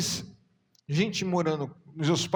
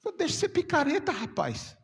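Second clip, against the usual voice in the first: higher, fast stern rebuke, lightly voiced; voice of God; 8 semitones higher than usual. God rebuking the preacher, lightly voiced sternness. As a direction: scold like a stern heavenly voice. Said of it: "aí eu ouvi Deus falar assim".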